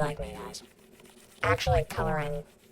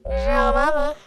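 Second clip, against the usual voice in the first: goofy yell; 11 semitones higher, 9 dB louder, slower than usual. Pretend. Goofy loud exclamation.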